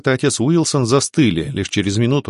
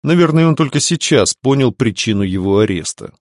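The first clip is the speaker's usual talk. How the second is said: plain reading voice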